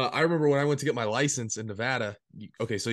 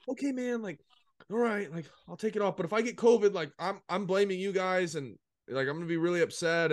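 mock worry; 7 semitones higher than usual. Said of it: "I'm like".

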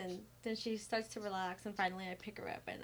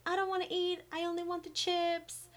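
put-on higher whiny little-girl voice; imitating toddler; 8 semitones higher than usual. Mom mimics daughter refusing to eat, whiny toddler voice. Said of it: "So then she's like".

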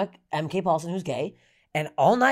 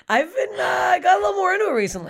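giddy confession; 10 semitones higher than usual. Real, not acted. Embarrassed confession.

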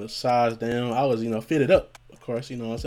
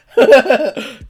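loud, high burst of laughter; laughing; 14 semitones higher, 14 dB louder than usual. Real, not acted. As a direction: crack up at your own joke. Laughs at his own joke.

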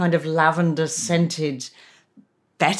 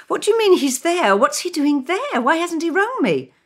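higher, sharp disbelieving questions; a wife stunned her husband is there; shocked questions; 11 semitones higher than usual. Acted. Reads Christine's shocked questions.

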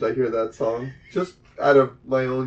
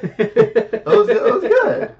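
laughing; 10 semitones higher, 6 dB louder, much slower than usual. Real, not acted. Laughing praise of the impression.

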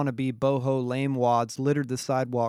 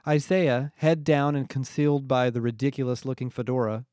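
usual speaking voice

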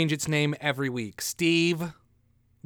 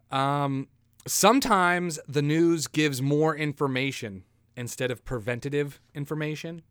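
ordinary talk, nothing marked

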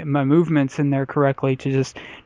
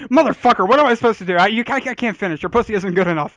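higher, louder hammed-up frustrated rant; mock angry rant; 7 semitones higher, 4 dB louder, faster than usual. Joking about cursing out his girlfriend, hammed-up rant. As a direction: rant at her in mock outrage.

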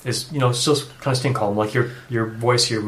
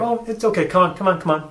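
coaxing; 8 semitones higher, 3 dB louder than usual. Retold or imitated. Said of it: "like, you're".

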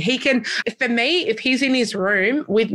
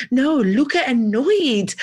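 lightly voiced toddler, higher and slow; 5 semitones higher, slower than usual. Son telling mum he's annoyed, in a light toddler voice. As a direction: play the stubborn two-year-old, drawn out. Toddler naming his feeling.

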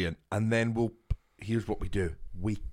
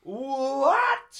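outraged what; 13 semitones higher, 4 dB louder than usual. Real, not acted. Outraged disbelief.

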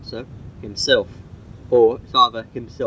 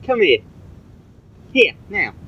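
higher, firm call to the dog; 7 semitones higher than usual. Real, not acted. Owner summoning the dog, higher firm call. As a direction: summon him to stay put.

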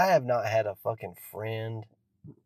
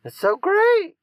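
mock preacher gush; 3 semitones higher, 7 dB louder than usual. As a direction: overblown fake delight, ending in a squeal. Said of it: "for everyone to watch and say".

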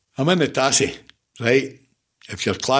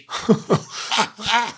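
a real laugh at the memory, pitched up; high burst of laughter; 10 semitones higher than usual. Real, not acted. Laughs at two-player training.

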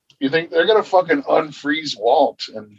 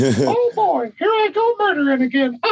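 high squeaky cheerful alien assassin; chirpy little killer alien, way up high; Krombopulos Michael voice. Retold or imitated. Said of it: "like Crumbopulous Michael from Rick and Morty".

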